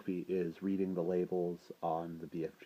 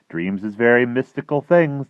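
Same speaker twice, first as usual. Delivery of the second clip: loud, higher-pitched giant's voice; BFG; 7 semitones higher, 15 dB louder than usual. A narrator's reading. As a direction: explain it big and emphatically. The giant explains dreams to Sophie.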